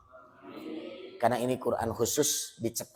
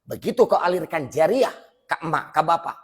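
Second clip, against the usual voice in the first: raised, emphatic preaching voice; preaching loud; 6 semitones higher, 8 dB louder than usual. Acted. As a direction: drive the point home loudly. Preaching at full force.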